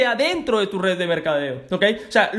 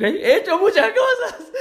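repeated through a high laugh; giggling repeat, much higher; 12 semitones higher, 3 dB louder than usual. Real, not acted. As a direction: laugh the words out, amused at yourself.

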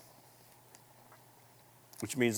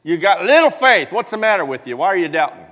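loud, higher berating; mock scolding; 5 semitones higher, 11 dB louder than usual. Preacher pretending to berate someone not healed, loud scolding. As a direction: tell them off loudly.

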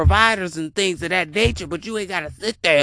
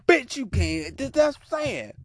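ordinary talk, nothing marked

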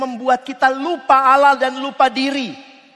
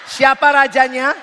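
normal talking voice

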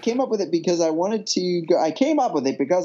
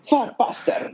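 put-on Scottish accent; 6 semitones higher than usual. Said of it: "You did it a little bit better".

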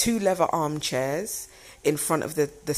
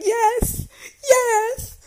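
high, loud squealed acceptance; shrieky, emphatic re-enacted joy; retold screeching; 6 dB louder than usual. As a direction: screech it out, overjoyed. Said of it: "screeching like I'm".

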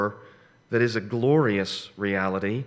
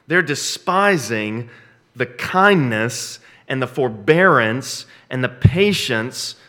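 slow emphasis; 6 dB louder, slower than usual. Real, not acted.

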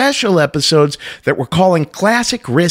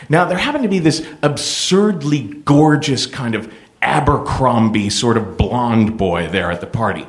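normal talking voice

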